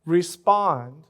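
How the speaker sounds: ordinary talk, nothing marked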